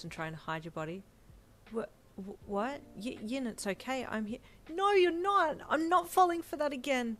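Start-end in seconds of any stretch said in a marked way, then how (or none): 1.7-4.4 higher-pitched, halting confusion; stammer, then gently try to reassure; taken aback and faltering; baffled stammer. Acted. Deku baffled, stammers to reassure her. 4.7-7.2 high, loud, distraught shout; frightened, defiant outburst; shaky yell. A narrator's reading. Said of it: "you yelled in a shaky voice".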